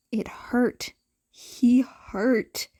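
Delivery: ordinary delivery, nothing marked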